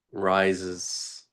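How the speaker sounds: ordinary talk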